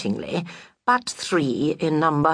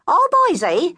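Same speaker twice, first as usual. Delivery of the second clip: much higher, louder busybody friend, reassuring then nosy; high fussy friend; 13 semitones higher, 5 dB louder than usual. Acted. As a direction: coo at the widow, then pry. Friend voice again.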